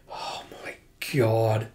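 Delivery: slow breathy whisper of disbelief; whispered aside. Real, not acted. Reacting to the story, whispered in disbelief. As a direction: barely voice it, stunned.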